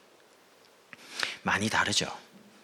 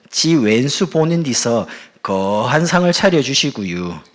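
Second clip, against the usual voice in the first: slow, loud Gangwon dialect recital; drawling comic Gangwon accent; 6 dB louder, slower than usual. Acted. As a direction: stretch out the endings in rustic Gangwon dialect. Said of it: "경상도 버전 말고 강원도 버전입니다".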